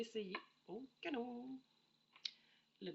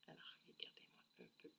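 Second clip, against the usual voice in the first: quick, hushed whisper; 17 dB quieter, faster than usual. Real, not acted. Showing viewers the cupcake bottle in a whisper. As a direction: lean in and whisper to the camera.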